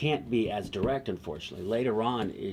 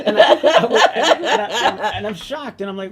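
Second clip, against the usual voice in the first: laughing; 11 semitones higher, 14 dB louder than usual. Real, not acted. Laughing while retelling the shock.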